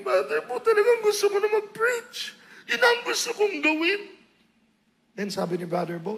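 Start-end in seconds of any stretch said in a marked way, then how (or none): 0.0-4.1 tearful plea. Retold or imitated. Answering Brother Bo through tears.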